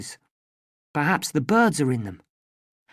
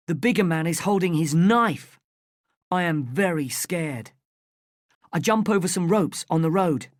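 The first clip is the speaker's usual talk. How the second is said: plain reading voice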